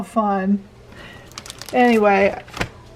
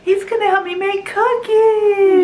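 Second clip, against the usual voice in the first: put-on high sing-song talk for a baby; 9 semitones higher than usual. Speaking for the baby.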